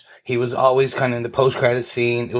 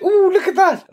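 high, louder retold squeal; excited squeal; 5 dB louder than usual. Podcaster re-enacting their reaction on the couch, excited high squeal. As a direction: squeal it out in delight. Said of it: "'cause I squealed".

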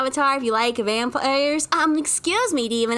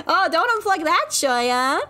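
laughing; 6 semitones higher than usual. Retold or imitated. Laughing at own past mishap.

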